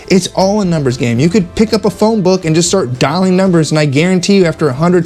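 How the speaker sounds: ordinary delivery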